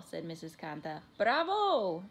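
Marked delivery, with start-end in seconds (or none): 1.2-2.0 high, loud, drawn-out praise; proud cheer. A narrator's reading. Mother praising her bilingual son, cheering. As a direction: cheer for a little one.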